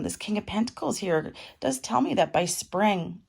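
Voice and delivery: ordinary talk, nothing marked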